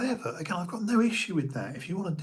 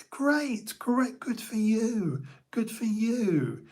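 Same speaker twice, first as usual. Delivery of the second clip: mock enthusiasm; 8 semitones higher, slower than usual. Real, not acted. Mock cheer for people's choices.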